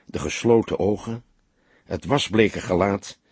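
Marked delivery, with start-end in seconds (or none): none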